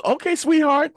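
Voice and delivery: high, loud, sugary mom saying yes; the trusting mom who hands it all over; playing the fooled mother, high and bright; doting mother voice. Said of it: "And she goes".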